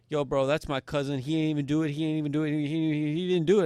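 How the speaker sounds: loud, high and pushy, defending his cousin; frantic yelling. Retold or imitated. Cousin jumps in defending him, loud frantic yell. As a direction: shout it in his face like a hothead.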